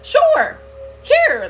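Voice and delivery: high, loud, eager squeal; naive excitement. Retold or imitated. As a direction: pitch leaps up, acting out naive eagerness. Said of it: "'cause I was excited".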